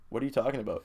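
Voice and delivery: softly played dumb, giving nothing away; quiet, cool denial; ice cold. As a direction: play it cool and innocent. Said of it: "They played me ice cold".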